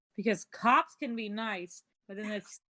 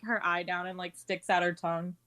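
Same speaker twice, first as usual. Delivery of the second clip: ordinary delivery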